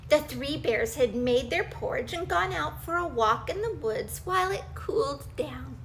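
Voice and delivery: usual speaking voice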